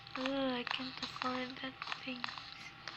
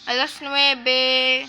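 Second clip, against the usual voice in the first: loud announcing; 13 dB louder than usual. Real, not acted. Announcing time loudly.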